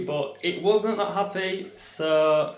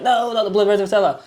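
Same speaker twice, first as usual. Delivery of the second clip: mildly raised, quick mock scream; retold scream; 5 semitones higher, faster than usual. Vlogger retelling how they screamed at their partner, mock yell. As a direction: act out a panicked protest, higher and fast. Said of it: "screamed 'cause I was like".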